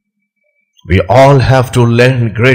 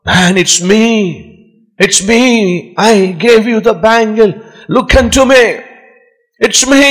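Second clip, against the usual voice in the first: calling out from afar; 7 semitones higher than usual. Acted. Prince calling down from the tower to the man, rising shouted plea. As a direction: plead to be noticed, voice rising steadily. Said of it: "The prince was shouting from the tower".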